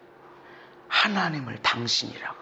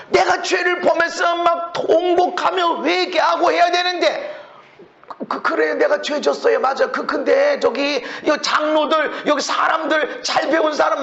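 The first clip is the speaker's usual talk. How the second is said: higher, louder whiny king begging; mock Saul pleading; 8 dB louder than usual. Preacher mocking Saul begging to be honoured before elders, whiny and loud.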